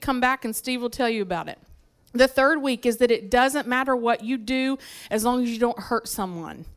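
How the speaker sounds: normal talking voice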